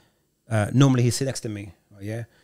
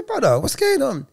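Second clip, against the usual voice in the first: concerned question; 13 semitones higher, 4 dB louder than usual. Retold or imitated. Worried driver asks a rocking teen.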